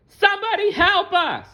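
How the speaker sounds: very high pleading bug voice; Bailey crying out. Acted. Bailey on the runaway leaf crying out in a high voice. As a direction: cry out like a tiny frightened ladybug. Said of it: "Bailey cried".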